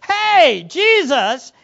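loud, high, bossy call; commanding. Acted. Disciples boldly calling out to Jesus, commanding. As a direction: demand attention as a unified group. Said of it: "they give commands to the Lord".

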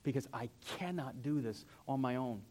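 mild, understated plea to the Lord; humble plea. Acted. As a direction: pray it quietly, giving up. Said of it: "unarmed at the foot of the cross going".

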